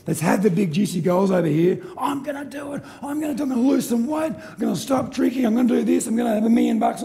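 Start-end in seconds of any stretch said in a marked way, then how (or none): 2.0-7.0 high-pitched mock enthusiasm, resolutions tumbling out; building mock resolve; eager goal-setter. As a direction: imitate someone hyping themselves up, pitched high. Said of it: "does anybody know anybody who's ever done this".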